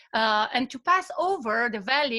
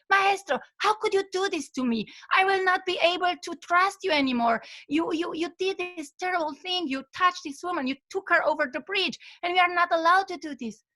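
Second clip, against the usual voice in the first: angry outburst; 6 semitones higher than usual. Retold or imitated. Apprentice finally snapping at his master on the trail. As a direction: let the held-back anger burst out at him. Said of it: "so angry".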